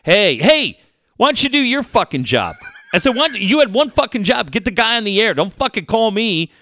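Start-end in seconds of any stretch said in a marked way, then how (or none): 0.0-2.6 angry call. Retold or imitated. PR guy yelling at him. 3.2-6.5 angry yelling back. Retold or imitated.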